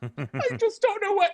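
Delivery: high, slow hammed-up sob; mock crying. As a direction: imitate a tearful breakdown in a squeaky voice. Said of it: "they're crying, and they're like".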